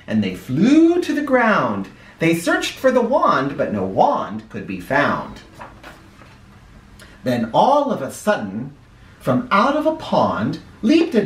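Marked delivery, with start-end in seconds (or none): none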